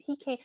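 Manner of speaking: "pecan" with a long ee, pee-CAN; pee-can pronunciation. Shown as a demonstration. Trying out her other way, pee-can pronunciation. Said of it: "I say both".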